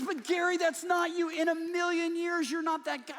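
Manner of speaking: high, loud, earnest protest; indignant wife. Retold or imitated. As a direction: defend your husband with high loud conviction. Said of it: "And she goes".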